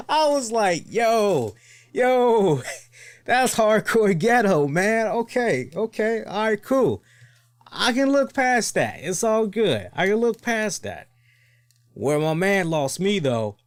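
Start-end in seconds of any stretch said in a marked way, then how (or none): 0.1-5.1 higher and louder, laughing yo yo; hyped-up disbelief at the cake guy; excited exclamation. Retold or imitated. Loud retold reaction to delivery. 5.1-11.1 amused acceptance. Retold or imitated. Mock self-talk, accepting it.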